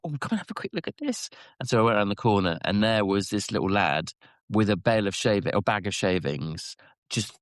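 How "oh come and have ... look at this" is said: mimicking boss. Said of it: "she was like".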